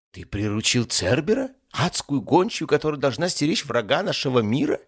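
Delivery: high, loud disbelief; astonished demon. Acted. Demon sees the tamed hellhound, astonished. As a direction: climb in pitch with incredulity.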